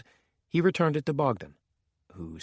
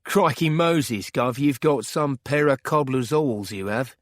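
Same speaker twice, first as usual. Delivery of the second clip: loud, drawn-out Cockney exclamation; Cockney geezer exclaiming with gusto; Cockney accent; 3 semitones higher, 4 dB louder than usual. A narrator's reading. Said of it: "going into a deep Cockney accent".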